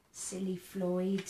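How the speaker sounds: usual speaking voice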